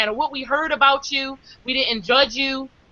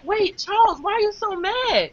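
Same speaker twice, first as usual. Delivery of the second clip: much higher, mock-innocent babyish voice; changed babyish voice; 8 semitones higher than usual. The mentor whining to Charles, in a much higher babyish mock voice. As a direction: mimic a grown man going suddenly childlike and innocent. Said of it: "his whole voice changed".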